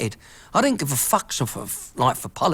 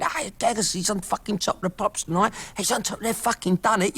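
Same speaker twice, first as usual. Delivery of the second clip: higher-pitched, mildly excited mock greeting; imitated excited locals; 5 semitones higher than usual. Locals hailing the hero of the manor, mock excited. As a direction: voice impressed neighbours spotting a local hero.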